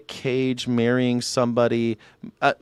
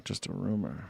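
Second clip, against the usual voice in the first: muttered echo; 4 semitones lower, 13 dB quieter than usual. Real, not acted. Mocking echo of "just a rumor.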